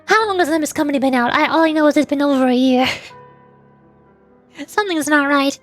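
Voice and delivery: usual speaking voice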